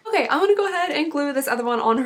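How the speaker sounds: ordinary delivery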